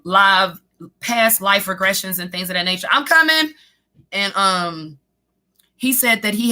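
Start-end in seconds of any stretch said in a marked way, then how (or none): 2.9-3.5 calling out. Real, not acted. Calling to someone off-stream.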